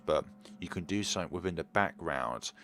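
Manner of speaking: normal talking voice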